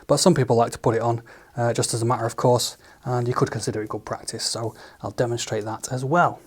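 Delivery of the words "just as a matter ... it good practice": ordinary delivery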